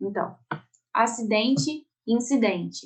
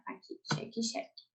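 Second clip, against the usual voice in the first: whisper; 13 dB quieter than usual. Shown as a demonstration. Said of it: "whispered repeat of the pair".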